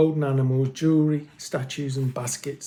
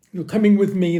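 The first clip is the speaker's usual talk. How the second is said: louder stern command; louder stern guard; 4 semitones higher, 8 dB louder than usual. A narrator's reading. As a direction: order them to come. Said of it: "the guard said sternly".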